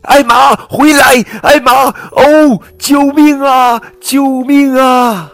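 high, loud panicked yelling, slowing at the end; shouting. Acted. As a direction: scream for help across the wind. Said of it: "韦伯叫道". (高声惊慌呼喊)

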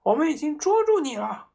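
high, loud shouted charge; girl charging the room with a knife; battle yell. A narrator's reading. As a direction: bellow it storming in. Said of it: "他哇哇叫着".

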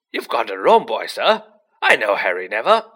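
loud, insistent protest with big pitch swings; frantic, booming defence; agitated ranting. A narrator's reading. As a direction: rant on urgently at full voice. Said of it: "Hagrid went ranting on".

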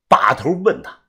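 plain reading voice

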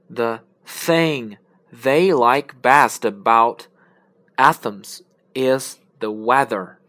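loud, slow, careful tongue-twister reading; teacher reading an example aloud; example sentence. Shown as a demonstration. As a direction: read each th clearly and slowly. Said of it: "用到这些的一个句子".

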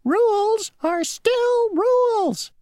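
a tiny mock shout, drawn out; the mosquito insisting on his rule, a mild mock shout. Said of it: "shouted the mosquito".

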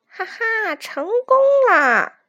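high, drawn-out gleeful crow; sneaky gloating. A narrator's reading. Weasel running off after tricking roosters, gloating. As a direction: laugh slyly, then stretch out the triumph. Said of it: "黄鼠狼边偷笑边往回跑".

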